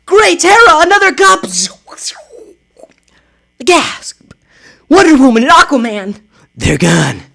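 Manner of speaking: high, loud heroic Supergirl voice; a superheroine calling out in alarm; high heroine voice. Acted.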